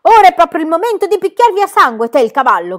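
very loud, high bellow; furious shout. A narrator's reading. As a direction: roar it with rage. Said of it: "gridò".

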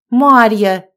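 loud scolding call of a name; indignant. A narrator's reading. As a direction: call her out sharply, shocked. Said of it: "возмутилась Анжелика". (возмущённый окрик)

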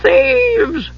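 very high, drawn-out shriek. A narrator's reading. Monk trying to drag the door closed, shrieking. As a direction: shriek it at the top of the pitch range. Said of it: "Bertram shrieked".

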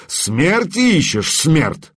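slow, threatening high snarl; menacing growl. A narrator's reading. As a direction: loom over him and menace. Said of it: "прорычал он".

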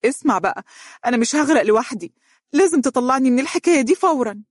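high-pitched, fast, agitated tirade; angry accusation. A narrator's reading. Woman blaming her lover for it all, in agitated anger. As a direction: blame him and demand a way out.

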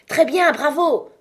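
loud, high cheer; acted burst of applause in the voice; exclaimed bravo. A narrator's reading. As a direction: cry out delighted approval. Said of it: "s'écria le sergent".

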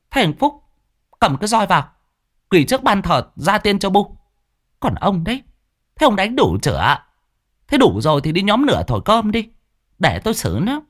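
high, stern woman's voice giving orders; stern mother. Acted. Mother orders her son and husband about, stern high woman's voice. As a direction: take charge, firm and sharp. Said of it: "Giọng bà cũng đanh lại".

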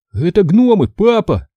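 high urgent cry to dad; shouting. A narrator's reading. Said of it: "кричу я".

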